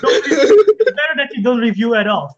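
high, loud mock indignation; mock outrage. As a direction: squeal it in mock protest. Joking demand for reviews.

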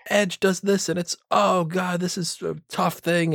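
slow it down and sell the hurt; lightly mocked dramatic wrestling commentary; Jim Ross. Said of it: "he'd be selling everything".

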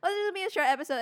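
lifted, sing-song mock quote; high mock quote. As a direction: toss it off high and airy. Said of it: "you see, like".

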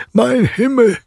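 high, amused outburst; laughing exclamation. A narrator's reading. Godfather answers the worried mother, high and amused. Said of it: "erwiderte Drosselmeier lachend".

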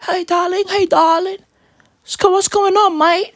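high mock British greeting; dainty British caricature. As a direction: coo like a British pop star, high-pitched. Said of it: "[name] would be like".

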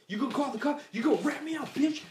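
high mocking gangster voice; taunting threat. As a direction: throw the threat back at him. [name] taunting dad.

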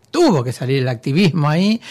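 ordinary delivery

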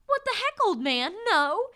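high, loud mock yelp; shocked outburst. [name] yelping at the old man in mock shock. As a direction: blurt it out in comic disbelief.

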